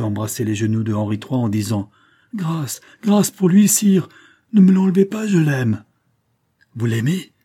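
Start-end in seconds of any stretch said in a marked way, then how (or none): 2.3-5.8 high, loud, desperate plea; anguished woman pleading at full pitch; cry of despair. A narrator's reading. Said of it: "un cri de désespoir". 6.8-7.3 mildly appalled question; a touch of dread; horrified. A narrator's reading. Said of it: "reprit le roi épouvanté".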